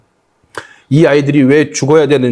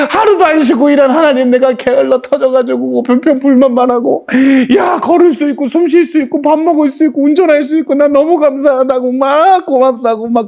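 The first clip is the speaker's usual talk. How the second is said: overwhelmed gratitude; 9 semitones higher than usual. Retold or imitated.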